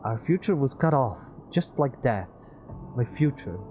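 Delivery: put-on high, loud bitter young man; despairing Peter. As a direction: voice Peter losing hope.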